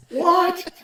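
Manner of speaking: high, loud disbelieving what; shocked what. Retold or imitated. Rep hearing the stick was made wrong, stunned. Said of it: "I'm like".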